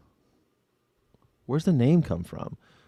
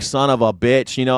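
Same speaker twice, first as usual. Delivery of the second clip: usual speaking voice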